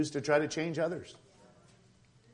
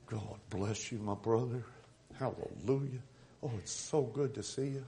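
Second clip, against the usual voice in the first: soft, sugary Southern greeting, much quieter; Southern church greeting; 4 semitones lower, 8 dB quieter than usual. Retold or imitated. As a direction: coo it like a sweet Southern church lady. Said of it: "Southerners that were First Southern Baptists".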